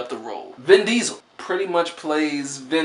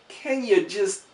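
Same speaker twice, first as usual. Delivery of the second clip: pleading; 9 semitones higher than usual. Retold or imitated. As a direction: beg gently for restraint, voice up high. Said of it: "where I was like".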